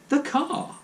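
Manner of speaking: stunned, high and breathless; shocked gasp. A narrator's reading. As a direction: choke out the words in shock. Shocked father again.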